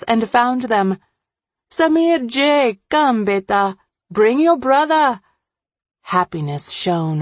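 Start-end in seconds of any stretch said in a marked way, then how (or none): 1.7-5.2 put-on high mother's call to her boys; happy calling. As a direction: call the children over with joy. Happily calls her imagined boys.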